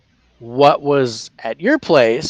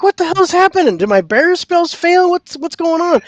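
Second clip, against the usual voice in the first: high mock panic; retold alarm; 13 semitones higher than usual. Recalling the barrier spell failing, high mock panic. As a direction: pitch the worry way up. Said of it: "I'm like".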